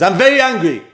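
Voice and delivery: loud, high angry declaration; acted burst of anger. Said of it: "He's angry or sad and says".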